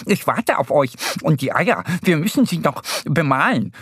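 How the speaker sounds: agitated hare, pitch jumping about; excited. A narrator's reading. Easter Bunny to late friends, excited and urgent. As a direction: fret excitedly as the waiting hare. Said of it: "ruft er aufgeregt".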